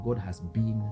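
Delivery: ordinary talk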